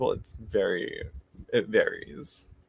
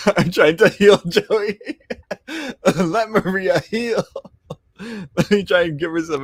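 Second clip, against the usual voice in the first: real giggles breaking into the words, higher and louder; laughing through words; 8 semitones higher, 5 dB louder than usual. Real, not acted. Reader cracking up while reading tarot for Maria, laughing. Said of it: "I'm laughing".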